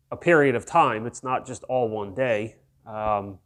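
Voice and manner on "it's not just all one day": ordinary delivery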